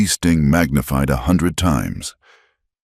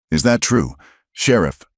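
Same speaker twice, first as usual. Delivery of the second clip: a stranger calling out from the back of a crowd, raised and higher; man at the back calls out to the sheriff, raised voice; called out; 5 semitones higher than usual. Acted. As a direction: shout the question across the crowd. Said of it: "a man from the back called out".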